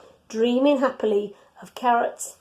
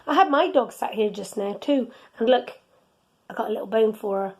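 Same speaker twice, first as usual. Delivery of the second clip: usual speaking voice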